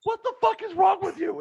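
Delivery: furious shout. Retold or imitated. Wife scolds him for ogling.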